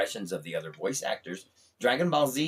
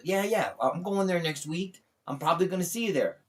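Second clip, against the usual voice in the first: [name]; 7 semitones higher than usual. Retold or imitated. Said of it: "He goes".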